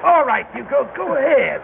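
high, taunting commands; gloating orders. Acted. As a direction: sneer as you order the beating. Boss orders a beating.

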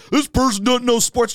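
high, sneering mock of a critic. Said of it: "people that talk big shit about, like".

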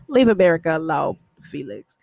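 a low, fast put-on accent for Felix; lightly mock his funny accent; imitating a gangster's funny accent. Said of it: "from that funny accent".

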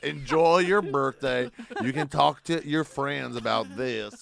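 slow, loud southern drawl; mock southern accent. Comic taunting an offended crowd, slow mock southern accent. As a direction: send them off in a drawn-out southern voice. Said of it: "give them a southern accent".

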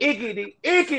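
high, squealed rhyming punchline; Dolomite. Acted. Host finishing his Dolomite rhyme, high comic character voice. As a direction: pitch the rhyme way up for comedy. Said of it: "Do a Dolomite character".

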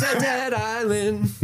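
slow, high announcer slogan; sing-song jingle read; mock slogan.

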